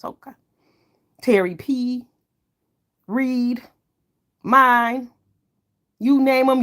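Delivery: normal talking voice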